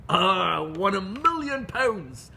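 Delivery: put-on slow, drawn-out southern English farmer accent; a pub farmer from southern England, taking his time. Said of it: "I'm gonna switch now to a southern, uh, uh, English accent".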